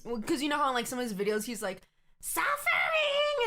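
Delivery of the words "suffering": drawn-out high mock wail of the word; jokey Riddler voice. Imitating the Riddler's jokey line in a drawn-out high cry. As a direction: stretch it into a high comic cry. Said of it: "jokey with the voice".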